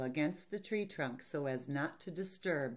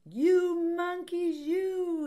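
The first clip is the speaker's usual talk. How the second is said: high, loud, drawn-out storybook scolding; scolding peddler; 8 dB louder, much slower than usual. Acted. Peddler scolds cap-stealing monkeys, hammed-up scolding. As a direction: stretch out a hammed-up telling-off. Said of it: "This made the peddler angry".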